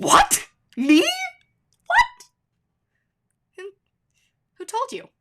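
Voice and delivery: squeaky high mock outrage, hammed-up; mock shocked disbelief. Retelling her reply to feedback about talking fast, hammed-up shock. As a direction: play innocent, pretend you can't believe it. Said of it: "And I said".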